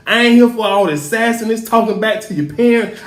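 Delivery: ordinary talk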